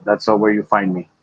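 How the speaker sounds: ordinary talk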